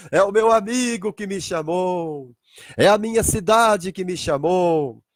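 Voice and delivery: slow, long-drawn proclamation; joyful recognition. Acted. Abraão recognising his friend's call, drawn-out joy. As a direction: draw out each phrase in glad recognition.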